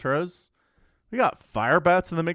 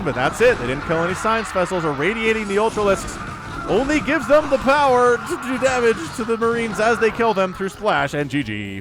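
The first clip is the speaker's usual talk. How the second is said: normal talking voice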